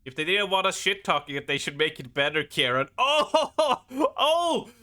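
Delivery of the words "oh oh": excited cheering. Real, not acted. Streamer cheering a slam dunk to chat, high excited shouts. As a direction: cheer the big hit at the top of your voice.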